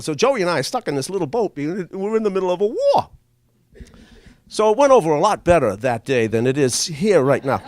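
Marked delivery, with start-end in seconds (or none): none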